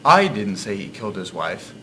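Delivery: "I" stressed, rest of the sentence falls away. Shown as a demonstration. Narrator showing how inflection changes meaning, stress on "I". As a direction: lean hard on the opening "I". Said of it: "Consider the following example".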